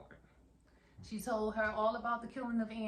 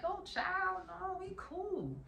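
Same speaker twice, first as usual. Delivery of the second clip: put-on much higher, far-off girlish soothing voice; high nervous reassurance; 12 semitones higher, much slower than usual. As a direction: reassure her while edging away.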